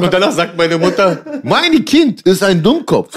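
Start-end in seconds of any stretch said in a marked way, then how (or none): 1.4-3.1 put-on slow Turkish-accented broken German; halting mother with broken German. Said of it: "mit gebrochenem Deutsch".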